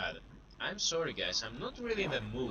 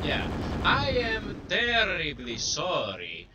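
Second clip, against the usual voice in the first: slow, mock-formal Greek accent; 6 semitones higher, 4 dB louder, slower than usual. Apologizing to viewers in a hammed-up Greek accent. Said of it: "gonna talk to a Greek accent".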